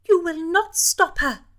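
high, loud command. Acted. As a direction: order him to halt. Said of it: "she commanded the Marquis".

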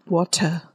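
water with a crisp aspirated T; British water. Shown as a demonstration. Teacher demos British water. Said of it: "用英国腔来念这个字的时候呢".

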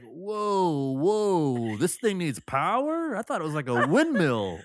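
high, loud dopey wonder; mock dumb amazement. Mocking a clueless customer, high dopey amazement. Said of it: "Has everyone been like".

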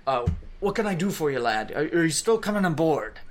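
put-on a jovial pirate captain's accent, rattled off fast. Captain greeting Kosef at the gangplank in a pirate accent. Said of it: "making him have a pirate accent".

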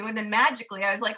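normal talking voice

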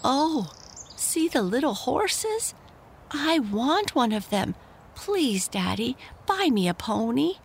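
put-on high, eager child's voice begging; excited little boy. George begging his father for a pony, high excited child voice. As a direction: squeal it like a boy who wants that pony. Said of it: "than he cried".

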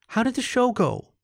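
high, feline female character voice; mewing cat. A narrator's reading. LaShonda asking Topher about his show, high mewing voice. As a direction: lift it high, like a waking cat. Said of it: "she mewed".

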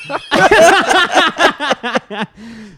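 loud high burst of laughter; cracking up at the bit; big laugh. Real, not acted.

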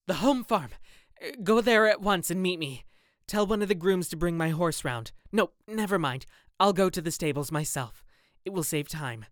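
urgent, almost breathless haste; frantic orders. Acted. Dorian frantically orders the gamekeeper to meet him.

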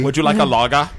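a German accent put on to tease the group; mock German accent. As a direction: offer a lager in a cheesy German accent. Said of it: "I really wanna do that voice now".

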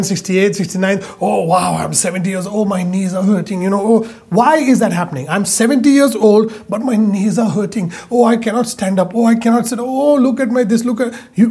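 normal talking voice

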